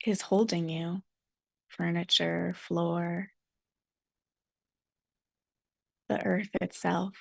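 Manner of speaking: calm meditation guide with long silences; guided meditation. Real, not acted. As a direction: settle listeners gently, phrase by phrase.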